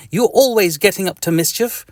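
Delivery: high, fussy telling-off; mock annoyed scold. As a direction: scold the little itch in a squeaky voice. Reader scolding the itch.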